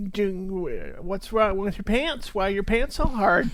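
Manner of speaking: usual speaking voice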